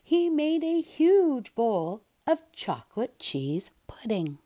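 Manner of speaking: plain reading voice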